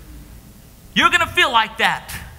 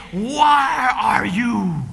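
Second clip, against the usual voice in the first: very slow, broken cry; much slower than usual. Acted. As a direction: wail the question, voice breaking. Jesus cries out forsaken.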